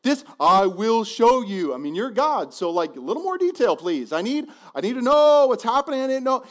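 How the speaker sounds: mock nervous. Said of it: "real nervous".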